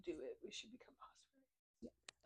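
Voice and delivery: hushed conspiratorial whisper. Real, not acted. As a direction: whisper it like a plot. Playful conspiratorial suggestion.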